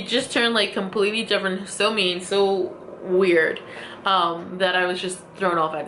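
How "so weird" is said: usual speaking voice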